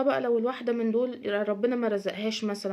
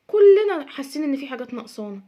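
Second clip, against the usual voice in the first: louder, stressing "كلنا"; louder emphasis; 3 semitones higher, 8 dB louder than usual. Real, not acted. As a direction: press the point hard. (تأكيد بصوت أعلى)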